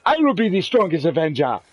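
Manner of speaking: mock-heroic accented proclamation. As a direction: declare it like an accented superhero king.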